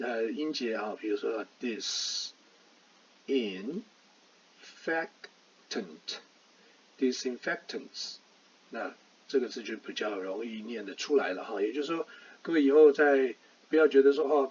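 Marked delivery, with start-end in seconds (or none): 1.6-8.2 very slow, each syllable of "disinfectant" split apart. Shown as a demonstration. Teacher sounding out "disinfectant" for learners, very slowly. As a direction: spell out "disinfectants" slowly, one syllable at a time. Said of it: "把它拆成三个音".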